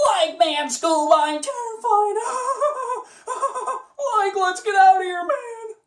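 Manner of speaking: high, laughing, terrified Shaggy; scared Shaggy. Shown as a demonstration. Shaggy telling Scooby he is terrified, high nervous laughter. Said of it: "that's really how I do it".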